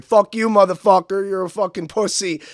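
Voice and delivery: retold drunk heckler yelling; rowdy heckler. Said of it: "he started getting rowdy".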